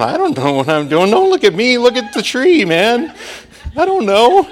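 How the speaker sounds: high, loud mock panic; hammed-up high panic, loud; panicked. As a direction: squeal it like a cornered preacher. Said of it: "I was going".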